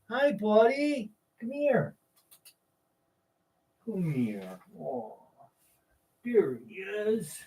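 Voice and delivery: slow, soft cooing at a dog; softly cooing to a sleepy dog; pet talk. Real, not acted. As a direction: coax him over gently.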